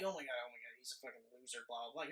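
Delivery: low, quiet throwaway imitation; mock dismissive viewer. Host imitates a viewer doubting the hero, mock dismissive. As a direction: rattle off mock disdain under your breath.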